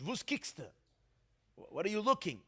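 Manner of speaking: put-on "Wos kickst du" in a Galician Yiddish accent, vowels shifted; a light Galician Yiddish rebuke. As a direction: quote the Rebbe in Galician Yiddish, "kickst" not "guckst". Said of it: "must have said in Galician, in Yiddish".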